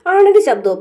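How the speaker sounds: sharp high shocked question; incredulous surprise. Retold or imitated. Deepthi scoffing at Varsha's question, loud and incredulous. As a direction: react as if the idea is absurd.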